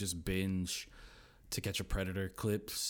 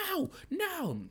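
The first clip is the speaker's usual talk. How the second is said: very high repeated cry; high-pitched mock protest; mock yelling. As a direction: imitate panicked yelling without full volume. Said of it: "just yelling".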